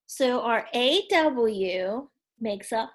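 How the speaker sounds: ordinary talk, nothing marked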